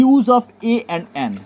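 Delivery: slow, word by word heading; slow dictation. Shown as a demonstration. Teacher dictating a new heading to the class, slow. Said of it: "প্রত্যেকে heading করবে".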